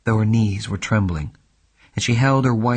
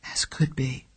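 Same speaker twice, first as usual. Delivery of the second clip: whisper; 5 semitones higher, 5 dB quieter than usual. Acted. Frightened client admits danger.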